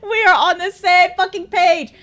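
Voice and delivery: loud, high, thrilled; delighted agreement. Real, not acted. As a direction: exclaim it with a laugh in the voice.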